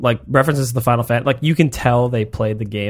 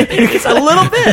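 laughing; 6 dB louder than usual. Real, not acted. Laughing mid-sentence.

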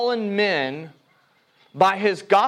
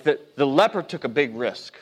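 usual speaking voice

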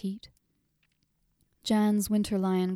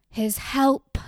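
higher, louder cry; shrieked; 5 semitones higher, 7 dB louder than usual. A narrator's reading. Grieving mother crying out for her dead son, shrieking. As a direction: fling the words out as a shriek. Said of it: "she shrieked".

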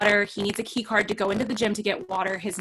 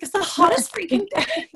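high put-on complaining voice; lightly voiced fed-up mom; mock whiny complaint; 8 semitones higher than usual. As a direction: whine it like a hot tired mom.

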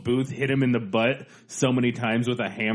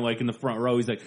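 ordinary talk

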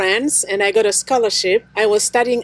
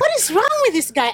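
baffled; 10 semitones higher, faster than usual. Retold or imitated. Retelling her confusion at husband.

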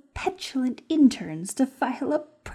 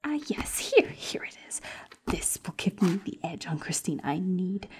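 scheming whisper; 7 dB quieter than usual. Acted. Schemer rifles through the doctor's files, whispering. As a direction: savour the snooping in a whisper.

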